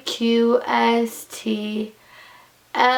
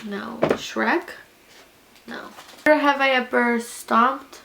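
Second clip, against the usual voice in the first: ordinary talk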